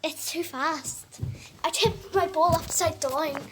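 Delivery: loud, high, upset; agitated loud. Real, not acted. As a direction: talk loud and rattled. Agitated explaining to mom.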